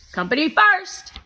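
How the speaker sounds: put-on high, loud pushy boy; Shake voice. A narrator's reading. Narrator voicing Shake, a loud, high pushy boy. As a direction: play the bossy guest kid, loud and high.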